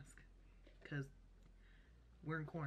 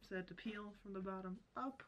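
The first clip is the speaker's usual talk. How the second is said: stiff cheeks under mask; through a face mask. Real, not acted. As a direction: lips framed by mask. Seen 4: Thick orange mask covers whole face around lips while speaking.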